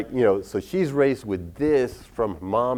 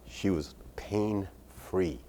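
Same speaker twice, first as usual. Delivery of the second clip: ordinary delivery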